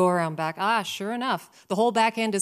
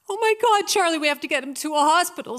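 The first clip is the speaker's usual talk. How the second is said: panicked mom; 7 semitones higher, 5 dB louder, faster than usual. Retold or imitated. Mom sees the injured dog.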